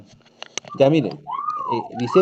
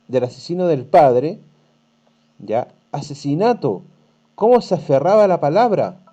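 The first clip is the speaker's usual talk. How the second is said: reading the story aloud to the class, a bit louder; teacher reading a tale to pupils, storytelling voice; 3 semitones higher, 4 dB louder than usual. Acted. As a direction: read the passage to students, lightly dramatic.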